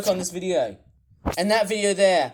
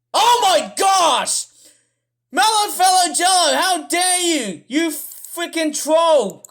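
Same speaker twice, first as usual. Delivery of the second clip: outraged yell, much higher and louder, slowing on the insults; angry rant; 7 semitones higher, 5 dB louder than usual. Real, not acted. Yelling at a commenter defending the channel, outraged shout. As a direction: blast the commenter in real fury. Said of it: "I'm starting to sound really mad".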